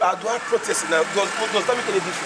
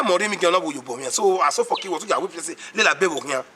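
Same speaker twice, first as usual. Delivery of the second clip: normal talking voice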